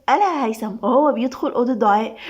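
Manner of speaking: ordinary delivery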